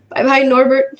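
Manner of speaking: sobbing. Acted. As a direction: sob out goodbye to the dragon. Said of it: "Hagrid sobbed".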